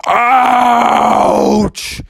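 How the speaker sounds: loud, high cry of pain; pained ouch. Acted. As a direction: yelp as the ball hits. Ball hits Bubby's teeth.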